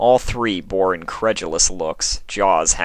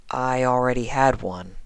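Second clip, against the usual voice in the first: sheepish; 6 semitones higher than usual. Acted. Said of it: "her sheepishness returning".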